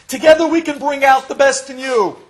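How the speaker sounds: high, loud rallying cry; mock a doctor's grand promise at full volume; mimicked doctor's pep talk. Patient quoting the doctor's promise, mock rousing therapist. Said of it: "you said to me".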